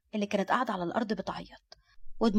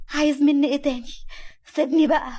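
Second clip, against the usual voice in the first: high, loud, frightened protest; desperate plea to be released; fearful; 6 semitones higher, 7 dB louder than usual. A narrator's reading. Nour is dragged up by her arm, pleading fearfully. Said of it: "وهي قالت بخوف".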